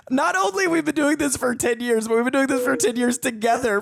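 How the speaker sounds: high-pitched, playful exasperation; mock indignation. Co-host teasing partner over a botched line, mock indignant. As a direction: build a mock complaint, pitched well up. Mock complaint about co-host's odd delivery.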